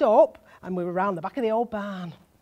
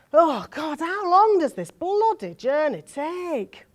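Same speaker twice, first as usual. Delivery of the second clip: higher, slow, drawn-out complaint; exasperated groan; 6 semitones higher, 5 dB louder, slower than usual. Acted. Passenger fed up with the long bus journey, exasperated. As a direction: moan it with impatience.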